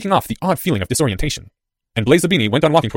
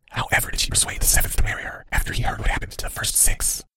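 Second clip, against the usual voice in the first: soft, voiceless question; whisper; 7 dB quieter than usual. A narrator's reading. Cloaked figure asks about the mother, whispered. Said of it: "said the whisper".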